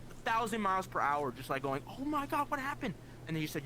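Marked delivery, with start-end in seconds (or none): 1.9-2.9 high-pitched mock panic over mom; retold panic. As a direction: relive the instant fear that mom died. Racing thoughts, frantic question.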